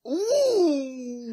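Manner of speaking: high, laughing ooh; a delighted teasing ooh; surprised ooh. Real, not acted. Reacting to Romero pick.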